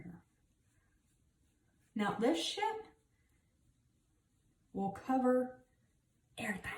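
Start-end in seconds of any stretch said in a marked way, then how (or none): 6.4-6.7 soft unvoiced whisper on "everything.". Real, not acted. Telling her viewers the foundation covers it all, whispered. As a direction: breathe out "everything." as a secret.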